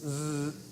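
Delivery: "Z" as a long voiced buzz. Shown as a demonstration. Phonetics teacher demos the voiced Z for the class, buzzing. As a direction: hold "Z" as a steady buzz, voice on. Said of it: "Go S and then Z".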